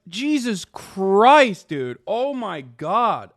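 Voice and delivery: ordinary talk, nothing marked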